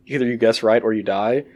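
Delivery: ordinary delivery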